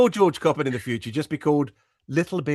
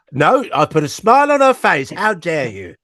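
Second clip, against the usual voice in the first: mock indignation; 2 semitones higher, 8 dB louder than usual. Guest protesting the co-host's "she's miserable" jibe, mock indignant. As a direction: play up being affronted, loudly.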